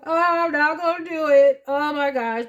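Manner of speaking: high, loud protest against welling tears; teary-eyed. Real, not acted. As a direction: hold back the tears, voice pushed up high. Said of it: "I'm getting teary-eyed".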